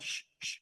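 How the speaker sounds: whispered shh shh; imitated shushing. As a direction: hiss a shushing sound. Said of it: "I have heard the comment of".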